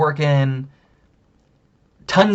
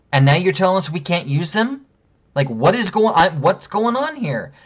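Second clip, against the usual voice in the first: higher-pitched, incredulous demands; retold indignant challenge; 6 semitones higher than usual. Husband challenging a cashier over the bags, indignant. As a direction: re-enact an exasperated customer confronting the cashier.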